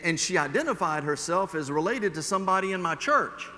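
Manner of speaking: usual speaking voice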